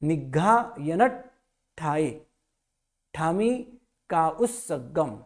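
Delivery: निघायन ठाए ठामी का उस्सगम slow, word by word; slow, separated words; careful recitation. Shown as a demonstration. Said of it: "य न और आधा ट".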